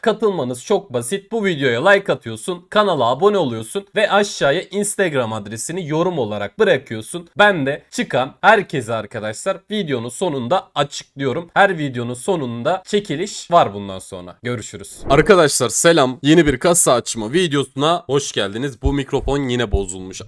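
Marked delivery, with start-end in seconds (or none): 0.0-14.4 loud, upbeat giveaway pitch, higher; promo announcement. Acted. Giveaway announcement at video start. 15.1-18.8 loud, bright intro greeting; channel intro. Acted.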